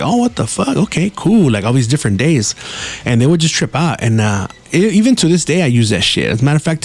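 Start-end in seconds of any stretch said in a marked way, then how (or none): 0.0-1.5 surprised. Retold or imitated. Coworkers amazed.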